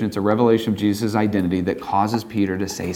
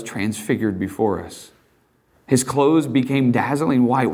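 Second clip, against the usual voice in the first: ordinary talk, nothing marked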